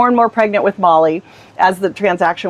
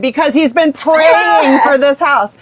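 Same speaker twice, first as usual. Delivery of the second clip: earnest explanation; 6 semitones higher, 3 dB louder, slower than usual. Retold or imitated. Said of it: "And she said".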